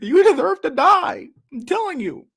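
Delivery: high, slow, strained mock lecturer voice; hammed-up high parody voice; mocking impression. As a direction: sneer it out in a squeaky parody of a stern pundit. Continues the mock voice.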